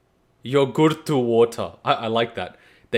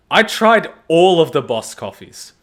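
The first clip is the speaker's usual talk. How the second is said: usual speaking voice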